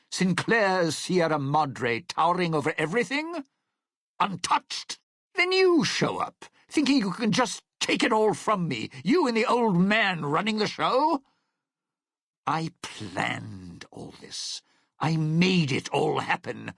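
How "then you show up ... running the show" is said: high-pitched, resentful tirade; indignant outburst. Acted. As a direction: pitch it right up, accusing the intruder. Bitter outburst at the intruder.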